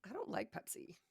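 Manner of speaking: whispered echo. Shown as a demonstration. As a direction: whisper it back. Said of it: "whispered echo".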